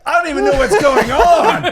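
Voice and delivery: bewildered outburst. Pretend. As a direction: throw up your hands, lost and loud.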